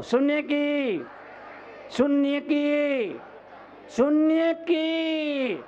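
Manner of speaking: high, slow, stretched-out crowd prompt; rousing call-out. Shown as a demonstration. Preacher telling everyone to say it together, rousing call-out. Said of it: "सब बोलो".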